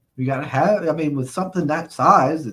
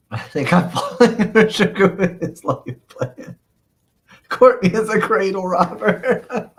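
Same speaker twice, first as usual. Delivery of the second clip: slow, louder, words bubbling with laughter; laughing while reading; 5 semitones higher, 5 dB louder than usual. Real, not acted. Streamer reading viewers' teasing comments, really laughing. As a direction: barely get it out through laughter.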